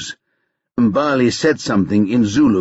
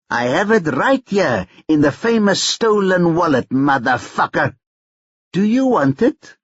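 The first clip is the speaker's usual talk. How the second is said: put-on higher, brash young hustler taunting on the phone; cocky Tyrone; 6 semitones higher than usual. A narrator's reading. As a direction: needle him, sure you hold the cards.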